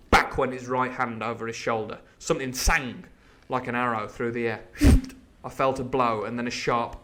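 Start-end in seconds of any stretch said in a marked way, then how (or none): none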